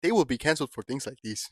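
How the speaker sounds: softly deliberate put-on African accent. Host warning about being canceled, mock African accent. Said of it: "I can do this accent".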